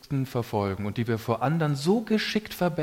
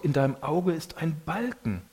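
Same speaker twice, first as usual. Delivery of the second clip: normal talking voice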